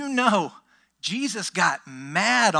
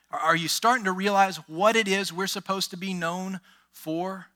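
usual speaking voice

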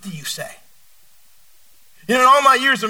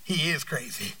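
giggly aside; 8 semitones lower, 12 dB quieter than usual. Retold or imitated. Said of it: "we would just look at each other and start giggling".

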